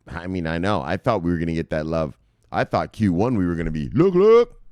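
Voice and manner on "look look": high, loud excited look look; excited call. Real, not acted. Host spots new listing, calls excitedly. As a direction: grab the viewers' attention.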